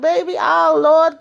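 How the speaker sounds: high, dramatic Lord; high over-the-top exclamation; mock exasperated cry. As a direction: throw up your hands in a high voice. Danny exclaiming in quote.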